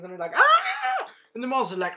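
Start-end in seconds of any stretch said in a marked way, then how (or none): 0.3-1.1 very high-pitched screech, badly faked; mock monster scream. Teller imitates monsters being stabbed, high mock scream. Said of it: "that's the monster scream".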